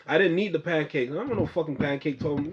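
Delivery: usual speaking voice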